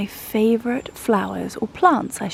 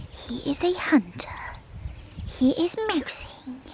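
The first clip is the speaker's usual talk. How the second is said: higher hushed whisper; 5 semitones higher, 5 dB quieter than usual. Real, not acted. Owner whispering about her dachshund hunting mice. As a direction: whisper as if watching a hunter.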